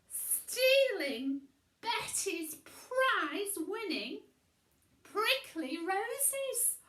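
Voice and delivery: high, loud, slowing on each word; outraged emphasis. A narrator's reading. Narrator's outraged build-up.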